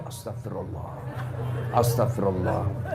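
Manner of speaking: low, soft pious murmur; hushed istighfar. Acted. Youth seeing pretty girl begs forgiveness, low and hushed. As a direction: drop right down into a penitent undertone. Young man repenting at tempting sight.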